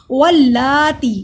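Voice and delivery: वल्लाती with a held double l; slow, loud recitation of वल्लाती; doubled laam. Shown as a demonstration. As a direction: join va to the l, stretch laa and tee. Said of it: "वाव को लाम से मिलाया".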